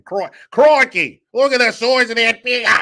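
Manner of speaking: loud, high caricature Aussie; hammed-up Australian shout; mock Australian accent. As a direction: holler like an over-the-top Australian adventurer. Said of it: "You're fucking Australian".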